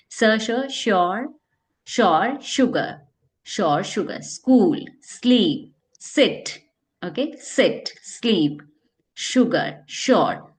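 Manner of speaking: श्योर, शुगर with clear sh, स्कूल, स्लीप with s; pronunciation drill; word drill. Shown as a demonstration. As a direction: drill sh and s words. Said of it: "दो methods बता दिए हैं".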